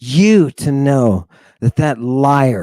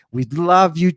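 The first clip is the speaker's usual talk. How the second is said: normal talking voice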